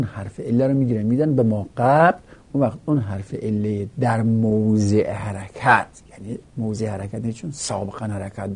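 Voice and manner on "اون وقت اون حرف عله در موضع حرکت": ordinary talk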